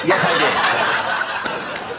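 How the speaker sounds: coy, breathy giggled yes; playfully flirting, half-laughing; flirty. Pretend. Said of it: "Are you flirting with me?".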